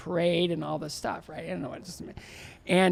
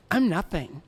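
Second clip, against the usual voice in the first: ordinary talk, nothing marked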